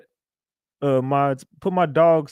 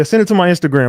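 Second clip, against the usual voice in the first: ordinary talk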